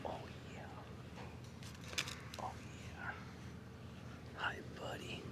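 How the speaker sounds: soft whisper. Real, not acted. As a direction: whisper hello to the horse. Greeting the horse.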